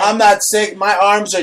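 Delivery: hammed-up wounded pride; indignant protest. Protests teasing about his weight.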